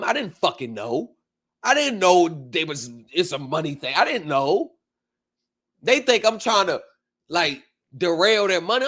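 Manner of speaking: ordinary delivery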